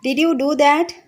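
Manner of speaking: father confronting his daughter; stern question. Acted. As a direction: demand an answer from the child. Angry father confronts child.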